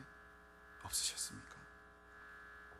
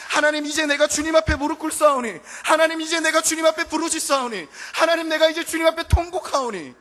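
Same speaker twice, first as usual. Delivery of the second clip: loud, high crying out to God; desperate shouted prayer; 12 semitones higher, 20 dB louder than usual. Acted. Worshipper crying out to God, loud and high. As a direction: plead at the top of the voice.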